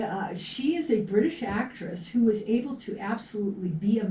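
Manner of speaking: ordinary talk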